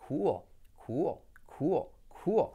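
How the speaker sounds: "cool" as KOO-ol; pronunciación correcta. Shown as a demonstration. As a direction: glide "cool" into a dark l, like another syllable. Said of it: "otra sílaba distinta". ("cool" como «cúol»)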